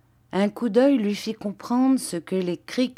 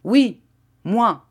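loud, high, defiant answer; Vitalis, unintimidated; 4 semitones higher, 6 dB louder than usual. A narrator's reading. Master answering the furious padrone, unintimidated. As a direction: stand firm, punch each word. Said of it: "sans se laisser intimider".

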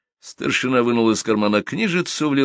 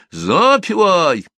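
shouting drill sergeant; 10 semitones higher, 7 dB louder, much slower than usual. Acted. Starshina orders marching song.

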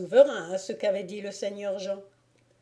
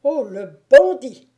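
loud, higher outburst; indignant cry; 5 semitones higher, 11 dB louder than usual. A narrator's reading. Bailiff hearing of the lord's advances, indignant cry. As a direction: explode on the insult. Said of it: "s'écria le bailli".

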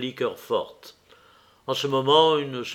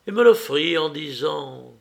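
ordinary delivery, nothing marked

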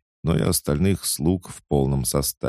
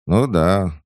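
high, loud, unimpressed agreement; reluctant agreement; 5 semitones higher, 7 dB louder than usual. A narrator's reading. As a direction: agree without much joy. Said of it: "не слишком радостно согласилась вторая". (нерадостное согласие)

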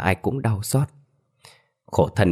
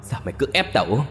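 raised, raging demand for a life; a vengeful ghost demanding payback; angry yell; 6 semitones higher than usual. Acted. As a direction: hurl the curse at him. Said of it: "giận dữ gào thét lên".

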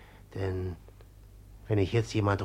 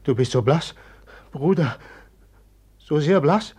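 louder, higher worried cry; alarmed concern; 4 semitones higher, 6 dB louder than usual. Acted. Pawin, frightened, tells his brother how pale he is. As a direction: alarm growing at his pallor.

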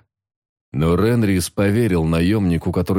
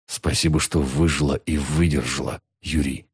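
quiet, choked-back tears; soft tearful; 4 semitones lower, 3 dB quieter than usual. A narrator's reading. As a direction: hold back tears and speak softly. Соно answers, hiding tears.

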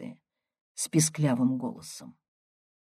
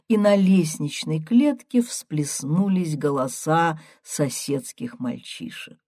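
usual speaking voice

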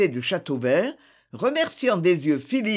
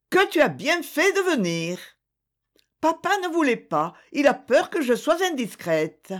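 higher, joyful voice of a welcoming mother; happy Eugénie welcoming the girl; joyful hostess; 9 semitones higher than usual. A narrator's reading. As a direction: greet her with delight. Eugénie greets Thérèse.

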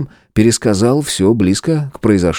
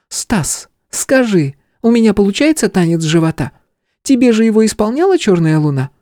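put-on playful young woman. Tipsy Stella asks about dancing.

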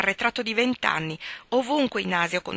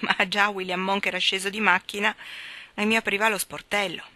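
plain reading voice

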